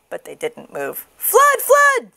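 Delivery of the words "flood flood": very high, loud warning; alarmed cry. A narrator's reading. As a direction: shout a high false alarm. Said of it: "he cried".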